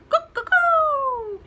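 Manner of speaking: high, loud, slow chicken call; cuckoo call. A narrator's reading. Small chicken calls out, high loud cuckoo. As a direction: crow it out loud.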